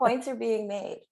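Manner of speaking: high, slow, laughing approval; laughing agreement. Real, not acted. Co-host backing the speaker's point, laughing agreement. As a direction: draw it out through real laughter.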